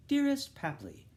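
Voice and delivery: put-on high, loud fond girlish call; daughter's letter voice. Bloom recalling his daughter's letter, high and loud.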